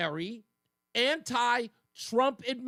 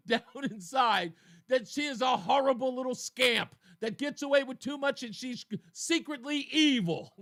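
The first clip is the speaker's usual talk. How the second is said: ordinary delivery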